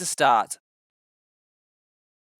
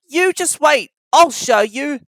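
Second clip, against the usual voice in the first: high, loud defiant; cross; 9 semitones higher, 8 dB louder than usual. A narrator's reading. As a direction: defy them crossly. Cross Thomas answers engines.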